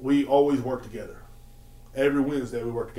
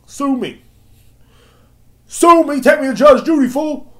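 much louder, much higher taunting yell; defiant shout; 13 semitones higher, 11 dB louder than usual. Real, not acted. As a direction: dare him to sue, at full volume. Defiant taunt at suing customer.

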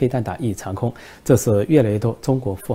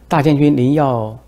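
谄媚讨好; 4 semitones higher, 7 dB louder than usual. Acted. 习近平讨好金正恩.

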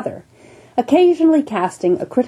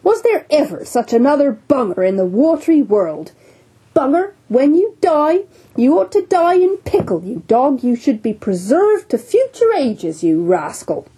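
teasing jovial captain; 4 semitones higher than usual. Acted. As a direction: rib the solemn doctor heartily. Captain mocks surgeon fondly.

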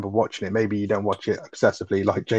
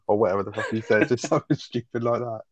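ordinary delivery

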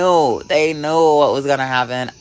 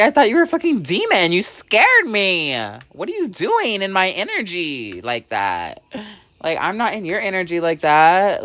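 mock shock; 9 semitones higher than usual. Retold shocked scolding of spirit.

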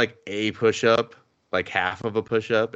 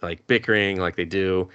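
ordinary talk

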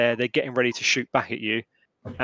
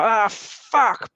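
higher, hurt cry of frustration; pained cursing; 9 semitones higher, 4 dB louder than usual. Acted. Game master voices a gunman clipped in the ear, pained cursing. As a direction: curse as if just hit. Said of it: "drop the rifle and be like".

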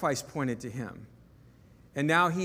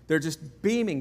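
ordinary delivery

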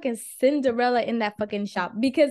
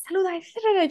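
pitched up, insistent saluda; mock nagging mom; 7 semitones higher, slower than usual. Mom barging in to make her greet, mock high. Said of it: "she comes up and she's like".

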